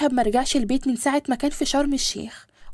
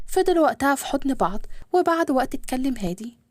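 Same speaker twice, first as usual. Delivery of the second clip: plain reading voice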